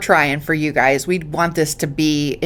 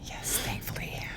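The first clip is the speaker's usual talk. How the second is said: whisper; 20 dB quieter than usual. Real, not acted. Whispered agreement.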